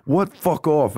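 high mock-angry brush-off; Ryan, angry. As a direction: send the stranger away, imitating irritation. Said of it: "like, Ryan was angry".

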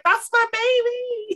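high, drawn-out doting coo; mock doting mom. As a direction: coo it like a proud, scared mother. S1 voices S0 watching gymnastics.

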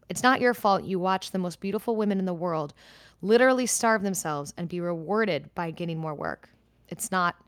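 ordinary delivery

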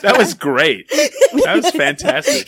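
real laughter, voice jumping high and slow; laughing. Real, not acted. Laughing hard at the friend's joke, slow and high-pitched. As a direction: crack up, pushing the words out through laughs.

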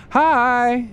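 high, cheery woman's greeting; sunny springtime voice. Acted. Rita squeezes into the mayor's office, sunny springtimey voice. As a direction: pop in with a high, sunny hello. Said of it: "springtimey voice".

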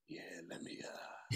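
creepy, hushed food order; inappropriate whisper. Pretend. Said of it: "so inappropriate with that whisper".

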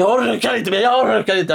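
hammed-up exasperation; mock despair yell. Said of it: "Och jag skriker".

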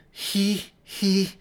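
mimicked breathing. A narrator's reading. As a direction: copy the breaths in panic. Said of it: "Mary mimicked".

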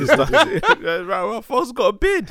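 high, loud laughing mockery; laughing mock reaction. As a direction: laugh while imitating a follower. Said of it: "you know".

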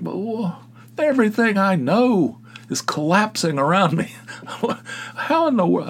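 high-pitched hammed-up shock; mock disbelief. Real, not acted. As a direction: act as if your world is collapsing. Shocked dad ate Cuban food.

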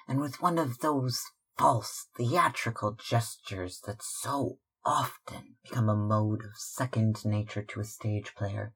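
ordinary delivery

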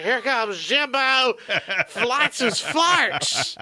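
drawn-out, slow race call; race announcer. Pretend. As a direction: stretch it like a track announcer.

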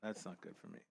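hushed, deflated groan of dismay. Real, not acted. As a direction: sink into a quiet let-down. Dismayed at the losing score.

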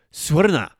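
loud, sharp command; stern command. Shown as a demonstration. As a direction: bark it at a drunk stranger. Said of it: "you can say".

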